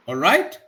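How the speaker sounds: "All right" rising sharply at the end; questioning greeting. Shown as a demonstration. Teacher showing a common UK greeting, questioning rise. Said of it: "very common greeting".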